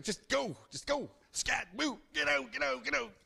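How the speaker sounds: high, scolding shoo-away voice; exasperated mother coyote; shooing mama coyote. Acted. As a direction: chase him out of the den.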